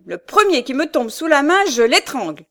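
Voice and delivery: high, loud furious warning; enraged threat. A narrator's reading. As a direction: threaten them at full voice. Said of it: "Un accès de rage furieuse s'empara de Michel". (menace furieuse, voix haute et forte)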